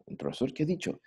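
ordinary talk, nothing marked

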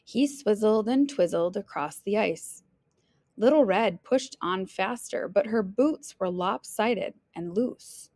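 plain reading voice

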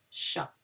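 "Chat" as "sha", quiet, no T; soft "Chat", silent T; soft model repeat. Shown as a demonstration. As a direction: murmur "Chat" again without the T. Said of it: "We don't pronounce the T".

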